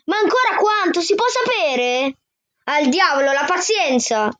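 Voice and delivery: put-on a boy fed up with waiting; exasperated outburst. (sfogo esasperato, più acuto)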